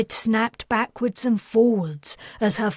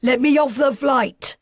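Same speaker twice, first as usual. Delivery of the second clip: loud, higher shouted demand; desperate to get off; angry demand; 5 semitones higher, 5 dB louder than usual. Acted. Father demands to leave.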